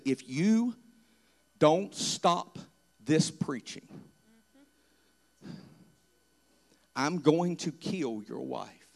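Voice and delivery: low, soft threat in the ear; soft sinister whisper of a threat; menacing whisper. Retold or imitated. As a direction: hiss it quietly like a voice in the dark. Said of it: "I had a whisper in my ear".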